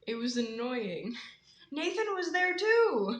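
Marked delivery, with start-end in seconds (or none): 1.7-3.2 high, loud, slow protest; indignant exclamation. Real, not acted. Complaining that the co-leader was there too, high indignation. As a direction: stretch it out high in exasperation.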